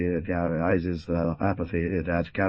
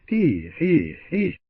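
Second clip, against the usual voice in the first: anxious exclamation; 10 semitones higher, 8 dB louder, slower than usual. Acted. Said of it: "exclaimed with anxiety".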